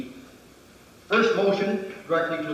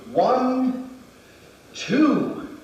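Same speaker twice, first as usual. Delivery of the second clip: called count; 7 semitones higher than usual. Shown as a demonstration. Instructor calling the count out to the class as he strikes. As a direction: bark the count as the strike lands. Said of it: "a complete movement".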